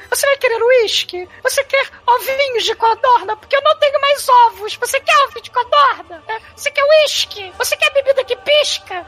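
very high thin waiter voice; high eunuch voice. Acted. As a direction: serve drinks in a squeaky high voice.

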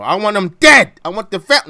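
loud, high mobster rant; furious hammed-up movie-gangster yell; Al Pacino. Host mock-raging at fan sites over the birthday, Al Pacino impression. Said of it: "go into my Al Pacino".

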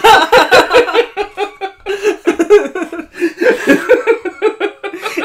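long high burst of laughing; cracking up hard; loud laughter. Real, not acted. Big laugh at Mark Damon.

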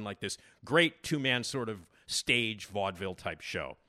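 usual speaking voice

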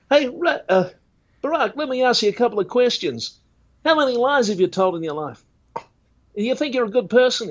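high, earnest street-preacher questioning; Ray Comfort impression. Impressionist plays Ray Comfort quizzing Obama, high and earnest. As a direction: pitch it way up like a pushy evangelist. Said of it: "doing Ray Comfort's voice is fun".

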